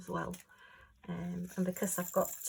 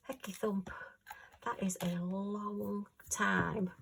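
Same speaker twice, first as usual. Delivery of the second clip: soft whispered aside, slow on the second line; hushed, wondering whisper; awed whisper; 4 dB quieter, slower than usual. Real, not acted. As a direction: breathe it out in amazement. Awed aside about seventy years.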